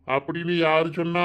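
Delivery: normal talking voice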